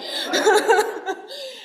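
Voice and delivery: loud nervous burst of laughter; embarrassed giggle in front of everyone. Real, not acted. Awkward gift presentation laughter.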